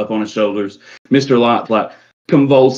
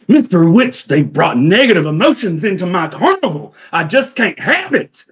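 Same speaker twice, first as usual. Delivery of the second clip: high, whiny theatrical outburst; distraught carnival owner; 7 semitones higher, 3 dB louder than usual. Acted. Mister Light complaining to Mister Witch, high distraught outburst. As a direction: wail the complaint at your partner.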